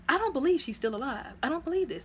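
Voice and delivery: high-pitched, mock-outraged disbelief; disbelieving protest. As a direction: protest like a viewer who won't buy it. Reaction to impossible survival.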